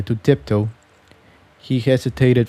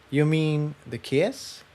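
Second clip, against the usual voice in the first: soft, higher shy question; asked quietly; 4 semitones higher, 9 dB quieter than usual. Acted. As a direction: hush it out, a little embarrassed. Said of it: "Tiptoe asked quietly".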